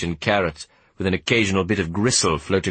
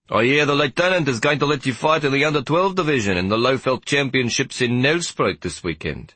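higher-pitched, lively character voice; chatty warder; 6 semitones higher than usual. Acted. Warder stops the boy for a chat, chatty. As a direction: play a bored warder making conversation.

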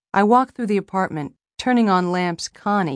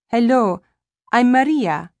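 put-on higher, louder South American-accented greeting; 6 semitones higher, 6 dB louder than usual. A narrator's reading. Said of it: "in a South American accent".

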